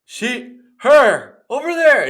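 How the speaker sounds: high, loud pointing yell; drunk shouting. Retold or imitated. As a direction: shout it across a loud club. Drunk pointing, shouting over music.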